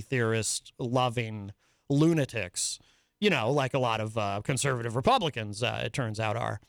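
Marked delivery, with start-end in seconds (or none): none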